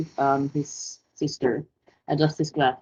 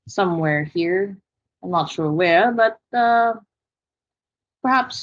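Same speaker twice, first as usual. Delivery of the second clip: louder, higher, formally polite half-orc; formal half-orc greeting; 3 semitones higher, 5 dB louder, faster than usual. Acted. Bookish half-orc introducing himself to the group, formally polite. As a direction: greet the table in a raised, courteous character voice.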